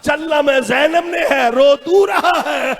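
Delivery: high, wailing recited lament; impassioned mourner imitating a weeping aunt; tearful. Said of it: "रो के कहती".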